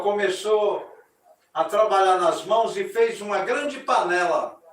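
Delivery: loud, ceremonious storytelling voice; story narration. A narrator's reading. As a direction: proclaim the myth to the audience. (narração solene e alta)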